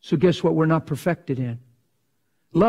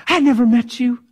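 higher and louder insistence; a pastor pleading loudly; raised plea; 8 semitones higher, 5 dB louder than usual. Retold or imitated. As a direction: cry it out at her. Passionate plea in quarrel.

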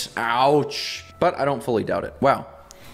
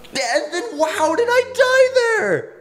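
high, loud, drawn-out wail; shrieked disbelief; 5 dB louder, slower than usual. Real, not acted. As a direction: squeal it in outraged bafflement. Shocked at dying in game.

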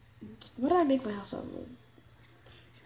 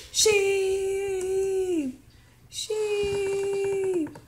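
calling out; 9 semitones higher than usual. Real, not acted. Kid calls out to sheep.

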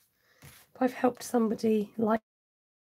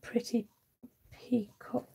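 whispered; 9 dB quieter, slower than usual. Real, not acted. Trailing into a whisper.

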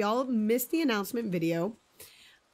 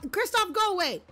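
shooing yell; 10 semitones higher than usual. Real, not acted. Villager Kristoff keeps following her.

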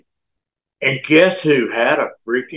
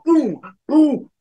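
mock boom chant; 9 semitones higher than usual. Retold greeting ritual with Duggan.

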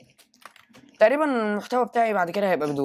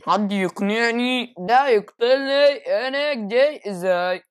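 whiny complaint; 6 semitones higher, 5 dB louder, much slower than usual. Real, not acted. Complaining about being killed.

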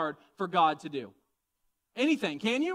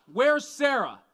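loud, raised question; higher, louder calling voice; attention-getting call; 5 semitones higher, 7 dB louder, slower than usual. Acted. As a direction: project it so an eavesdropper hears. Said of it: "he wants to get her attention".

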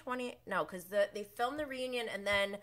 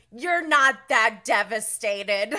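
loud, slow retold yell; mock yelled put-down; 3 semitones higher, 11 dB louder, slower than usual. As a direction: shout it dismissively. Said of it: "Jax yelling to Brittany".

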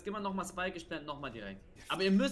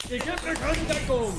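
frustrated complaint; 7 semitones higher, 6 dB louder than usual. Real, not acted. Complains no cover given.

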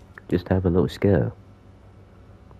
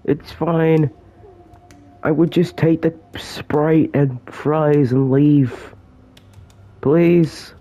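high-pitched mock begging; hammed-up pleading; 9 semitones higher than usual. Cornered player begging Ronald to let them go, hammed-up pleading. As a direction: plead pitifully, pitched well up, overacted. Begging the game monster for mercy.

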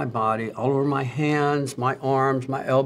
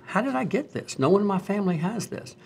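puzzled wondering; 7 semitones higher than usual. Retold or imitated. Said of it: "I started thinking".

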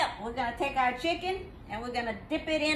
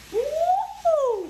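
high, joyful whoop; excited whoop; 6 dB louder than usual. Real, not acted. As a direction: shout it out with delight. After sauce bomb.

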